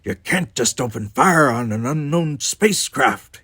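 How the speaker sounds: high, loud, raspy objection; rasped protest. A narrator's reading. Kirk playing doctor to himself, rasping. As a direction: rasp out an exasperated plea. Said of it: "he rasped".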